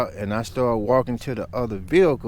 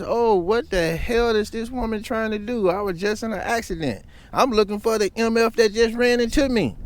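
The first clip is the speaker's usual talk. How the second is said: incredulous; 10 semitones higher than usual. Retold or imitated. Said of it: "the first thing come to my mind is".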